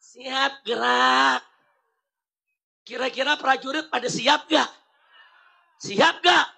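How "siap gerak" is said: limp command. Shown as a demonstration. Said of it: "Nyuruhnya bagaimana?".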